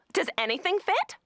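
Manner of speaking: high-pitched mock salesgirl; mean salesgirl. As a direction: poke in with a high, needling question. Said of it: "she was incredibly mean".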